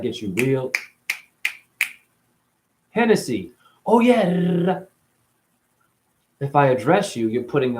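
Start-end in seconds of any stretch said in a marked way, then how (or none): 2.9-4.8 higher, louder bro cheer; hammed-up party bro voice; mock party bro. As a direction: sell it like a hyped homie. Acting out coded party talk.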